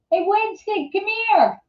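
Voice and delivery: high coaxing call to the puppy; calling the dog. Real, not acted. Owner calls puppy Winston over, high sing-song pet voice.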